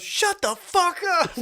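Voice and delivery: put-on high, loud little boy yelling; a tiny kid snapping at the adults; mock kid voice. Scene: retelling a viral video, mock little boy yelling.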